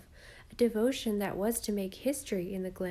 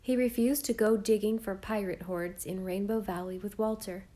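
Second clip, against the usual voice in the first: usual speaking voice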